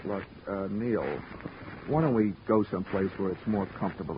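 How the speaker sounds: soft, hushed coaxing; soothing. Acted. Detective gently coaxing an unstable man to come along, softly.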